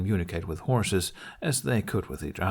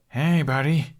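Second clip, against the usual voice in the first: calling out; 6 semitones higher, 4 dB louder, slower than usual. A narrator's reading. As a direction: friendly call across the barn, higher and louder. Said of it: "he called upon seeing".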